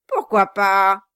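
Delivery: loud snapping comeback; loud dwarf retort. Acted. Quilp retorts to Brass, loud and mocking. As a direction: throw the question right back. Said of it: "répliqua le nain".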